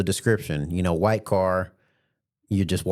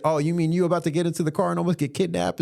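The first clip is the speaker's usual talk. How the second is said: teasing; 8 semitones higher, faster than usual. Retold or imitated. His own teasing reply.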